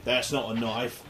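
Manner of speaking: a half-hearted Australian drawl on That's not a knife; Crocodile Dundee line. Retold or imitated. Host quoting Dundee to mock the game, mild Australian accent. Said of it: "I'd be able to say".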